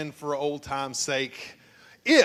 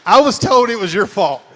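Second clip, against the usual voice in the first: loud teasing accusation, louder; mock accusing shout; 4 semitones higher, 12 dB louder than usual. Real, not acted. Pastor teasing James about the burnt rolls, mock shout. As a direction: call him out loudly, playful blame. Teasing the roll maker loudly.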